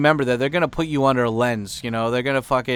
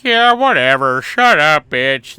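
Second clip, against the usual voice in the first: mock rude brush-off; 2 semitones higher, 8 dB louder, slower than usual. Guide mocked for brushing off the woman, loud sneer. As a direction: drag it out like a bratty dismissal. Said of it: "and then you're like".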